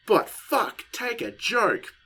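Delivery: high-pitched, emphatic protest; exasperated rant. Real, not acted. Podcaster ranting at critics who can't take a joke, exasperated. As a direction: plead loudly in exasperation, drawing out the last words.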